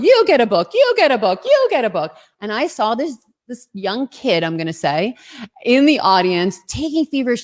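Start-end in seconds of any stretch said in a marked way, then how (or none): 0.0-2.2 mock game-show host handing out prizes; playful Oprah impression announcing gifts; Oprah giveaway. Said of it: "I wanted to pull an Oprah".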